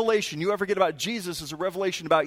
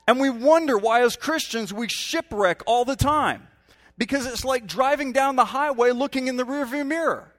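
raised, higher preaching voice pressing the point home; fervent preaching; 5 semitones higher, 3 dB louder than usual. Acted. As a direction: preach it out with full force. Preacher raises to full force.

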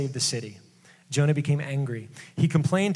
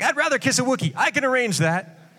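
high, cheeky comeback; mock sulky retort; 9 semitones higher than usual. Joking exchange between Jonah and God, high cheeky retort. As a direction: toss off the line like a brat. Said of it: "it's like".

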